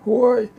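high, loud startled "oy"; ogre's yelp. A narrator's reading. Ogre losing a tuft of beard, high yelp. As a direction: yelp as if suddenly cut.

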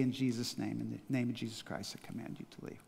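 fading to a hushed whisper. Shown as a demonstration. Pastor closing the teaching, whispered command. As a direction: let the command drop to a whisper. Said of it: "fades to a whisper".

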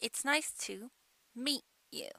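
"meet you" as mee, brief stop, you. Shown as a demonstration. Teacher giving an example, "meet" stopped before "you". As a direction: pause an instant in place of the T in "meet". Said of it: "間を取ります".

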